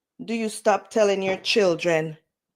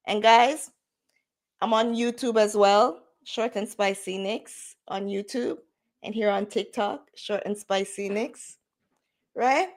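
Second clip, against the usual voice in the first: usual speaking voice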